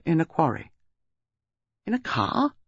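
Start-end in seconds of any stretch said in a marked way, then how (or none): 1.8-2.5 high, incredulous echo question; stunned, high-pitched query; astonished. A narrator's reading. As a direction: repeat it in disbelief, pitch jumping up. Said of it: "His astonishment was evident".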